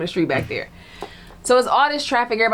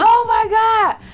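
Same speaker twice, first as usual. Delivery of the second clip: high, slow shocked gasp; imitated shock; 14 semitones higher, 5 dB louder, much slower than usual. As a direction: stretch out the friend's disbelief. Friend reacts to shooting news.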